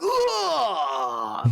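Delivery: high hammed-up cry after a drink; a mock warrior's loud "Ah!"; samurai shout. Samurai slamming down sake, mock satisfied cry. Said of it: "He's like".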